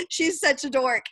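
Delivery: ordinary talk, nothing marked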